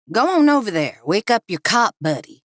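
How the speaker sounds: menacing gunman. Acted. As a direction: order a captive about at gunpoint. Gunman Cliff orders captive.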